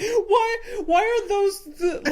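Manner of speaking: high, loud hammed-up yell; mock screaming question. Friend retells Jonah Hill screaming at McLovin.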